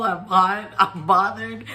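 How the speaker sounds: ordinary delivery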